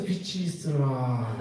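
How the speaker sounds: slow, low and solemn pronouncement; voice of God. Retold or imitated. God declaring the first light, slow and solemn. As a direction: intone it slowly like God speaking. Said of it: "하나님께서".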